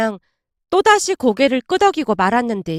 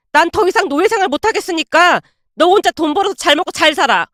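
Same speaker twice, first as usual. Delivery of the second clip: furious yelling; 4 semitones higher than usual. Acted. Wife finally snapping at her mocking husband, yelling furiously. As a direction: let years of held-back anger explode. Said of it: "빽 하고 소리를 질러버렸습니다".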